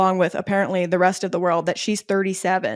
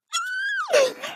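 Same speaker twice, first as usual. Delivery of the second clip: sharp gasp; 5 dB quieter than usual. Real, not acted. Vlogger reliving a favourite Queen Charlotte line, sharp gasp. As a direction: gasp as if the line still hits her. Reacting to remembered romantic line.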